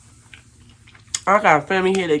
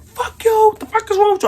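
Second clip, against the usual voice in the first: high, loud yelled curses; shouting curses at the neighbor; screaming match; 6 dB louder than usual. Retold or imitated. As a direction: yell it back in the fight. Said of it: "So it's a screaming match".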